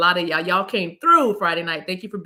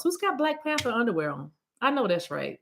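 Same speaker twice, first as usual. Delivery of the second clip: ordinary talk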